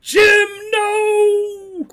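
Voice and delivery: high, loud anguished scream. Acted. Game master voices an attacker swiping at the player, screaming. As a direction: scream it out, stretching the last word.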